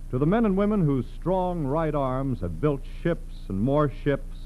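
ordinary talk, nothing marked

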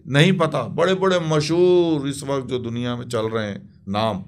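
ordinary delivery

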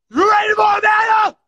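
hype shout. Real, not acted. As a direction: call out the challenge loud. Hypes the same challenge louder.

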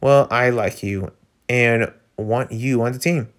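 ordinary talk

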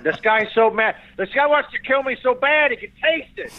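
high-pitched, worked-up film star; Al Pacino. Acted. Comic mimics Dog Day Afternoon Pacino, high and agitated. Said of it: "Dog Day Afternoon".

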